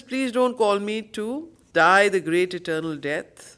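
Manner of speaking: ordinary talk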